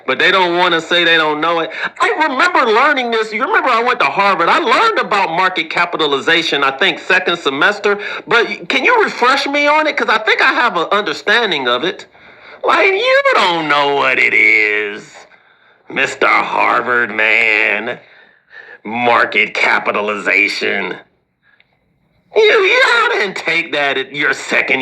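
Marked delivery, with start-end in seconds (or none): 2.0-12.1 pretend smart person. Harvard grad bluffing about a class he took, mock eager. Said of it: "this is how people that are intelligent". 12.6-21.0 slow mocking taunt.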